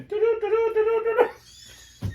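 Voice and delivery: high, loud sing-song ringtone; imitated ringtone. Phone rings out during graduation, high loud imitated ringtone. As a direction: mimic a phone ringing at full volume.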